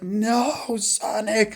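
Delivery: high pleading call; anguished cry. Retold or imitated. Tails crying out to Sonic in a high voice. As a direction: cry out for your friend. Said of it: "Tails is like".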